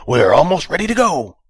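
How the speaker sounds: high, loud, scratchy intercom voice; scratchy speaker voice. Acted. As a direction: crackly voice over a speaker. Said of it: "with a scratchy voice".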